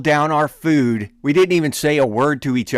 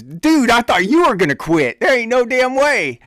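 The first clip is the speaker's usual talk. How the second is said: higher, louder scoff; imitating an excited buddy; retold incredulous buddy; 11 semitones higher, 5 dB louder than usual. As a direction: laugh off the idea of quitting.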